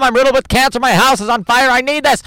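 high, loud mock desperation; stressed TV contestant. Mocking American TV contestants' sob stories, frantic and desperate. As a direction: plead frantically, hammed up. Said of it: "everything's so stressful".

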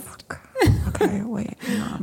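groggy, hungover and drained. Real, not acted. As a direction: say it like a pounding headache. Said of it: "I'm so hungover right now".

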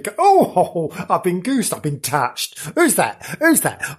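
mock-startled yelps and questions; startled goosed victim. Playing a ghost-tour visitor who's been goosed, mock startled. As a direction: act out someone jumping at an unseen touch. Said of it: "they get goosed occasionally".

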